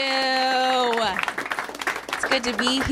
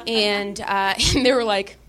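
ordinary talk

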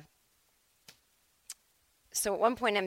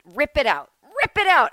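imitated flight attendant, building to high and loud; excited urging; 8 semitones higher, 7 dB louder than usual. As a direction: egg them on, second command much higher. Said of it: "She was all excited".

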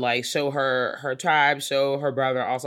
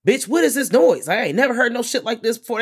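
bewildered colonizer; 7 semitones higher, 5 dB louder than usual. Retold or imitated. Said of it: "they just like".

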